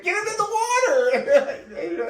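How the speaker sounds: very high, slow, loud cry; frantic wail. Retold or imitated. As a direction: wail it like a hysterical onlooker.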